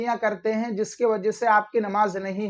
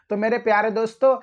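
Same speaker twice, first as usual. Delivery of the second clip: ordinary talk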